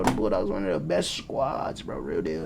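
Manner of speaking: low, toned-down hype; dropped-voice repeat. Real, not acted. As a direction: drop right down low to insist.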